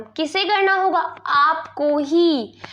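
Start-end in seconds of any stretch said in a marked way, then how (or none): 1.3-2.6 आपको ही drawn out slowly; emphatic, slow आपको ही; stress on आपको ही. Shown as a demonstration. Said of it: "बल लगाने के लिए".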